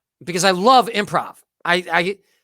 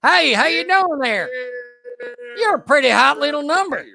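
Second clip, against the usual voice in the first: mock pickup line; 6 semitones higher than usual. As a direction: lay on the cheesy charm.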